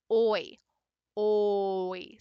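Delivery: teacher models oi, sliding the vowel; drawn-out oi glide. Shown as a demonstration. Teacher showing students how oi glides. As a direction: demonstrate oi slowly. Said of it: "Think about".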